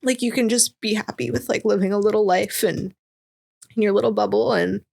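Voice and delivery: tearful, voice breaking, pitch up, words half-breathed; real tears, she pushes the words out anyway; crying while talking. Real, not acted. Guest tearing up on a friend's podcast, crying through her words. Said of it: "First time crying on your podcast".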